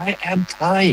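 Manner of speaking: high, showy English accent; showing off English. Shown as a demonstration. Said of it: "ผมก็ต้องโชว์นะ". (สำเนียงอังกฤษแบบโชว์)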